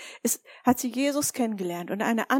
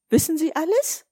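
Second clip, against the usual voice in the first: higher and louder, Wissen Sie alles; mock amazed question; 6 semitones higher, 6 dB louder than usual. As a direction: ask as if astonished. Retold question to students.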